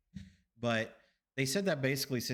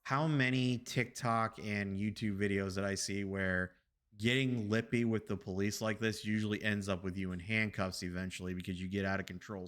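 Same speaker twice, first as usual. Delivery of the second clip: ordinary talk, nothing marked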